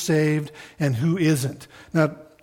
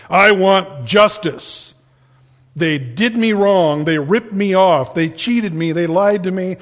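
louder, indignant claim for justice; aggrieved demand; 4 dB louder than usual. Retold or imitated. Wronged person demanding justice, mock indignant and louder. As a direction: stamp out each grievance. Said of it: "what we all demand when we're wronged".

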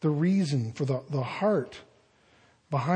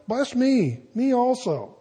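bitter pleading cry; 7 semitones higher than usual. Acted. Esau begging his father for a blessing, higher pleading cry. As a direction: plead with your father, voice lifted high. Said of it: "And Esau lifted up his voice and wept".

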